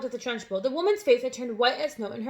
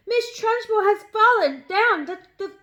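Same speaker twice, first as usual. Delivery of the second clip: excited scream; 9 semitones higher, 7 dB louder than usual. A narrator's reading. Boy screaming that the headmistress fell, high and loud. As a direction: cry it out in excitement.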